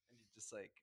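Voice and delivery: ordinary talk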